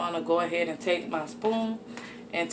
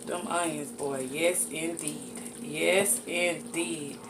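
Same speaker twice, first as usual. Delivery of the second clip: savoring; 5 dB quieter, slower than usual. Real, not acted. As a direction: murmur it slowly to yourself in admiration. Savoring the finished dish.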